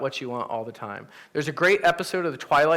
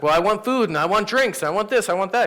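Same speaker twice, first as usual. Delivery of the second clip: higher and louder, greedily listing everything he wants; eager wishing; 6 semitones higher, 4 dB louder than usual. Retold or imitated. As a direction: rattle off wishes like a kid in a candy store. Man greedily listing his wishes.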